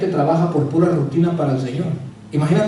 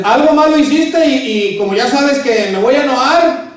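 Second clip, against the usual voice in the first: higher, louder, slow mock accusation; accusing wife; 7 semitones higher, 5 dB louder than usual. Wife accusing her husband over the gift, higher, louder and slow. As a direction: drag out the accusation like a suspicious wife.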